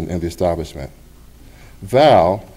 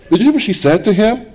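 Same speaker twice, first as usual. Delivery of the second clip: incredulous colleague; 13 semitones higher, 4 dB louder, faster than usual. Retold or imitated. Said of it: "another said".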